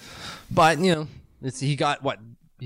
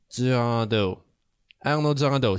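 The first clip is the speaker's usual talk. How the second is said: French pronunciation. Shown as a demonstration. Reading the French name aloud.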